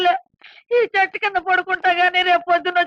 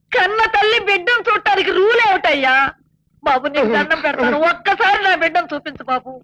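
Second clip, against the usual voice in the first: crying as she begs; mother begging the guard to see her son, weeping; tearful pleading. Acted. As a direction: plead through tears with folded hands.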